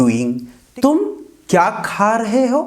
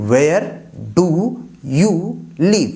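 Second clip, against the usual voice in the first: each word split off by a clear pause; teacher spelling out a question; much slower than usual. Shown as a demonstration. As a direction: dictate it slowly, one word at a time. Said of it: "teacher dictates word by word".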